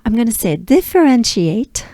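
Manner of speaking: usual speaking voice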